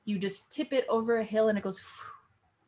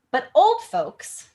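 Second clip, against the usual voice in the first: put-on louder, slow, exasperated But old folks; exasperated line reading; 5 semitones higher, 10 dB louder, slower than usual. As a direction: bite into old folks with scorn. Reads Juliet's complaint.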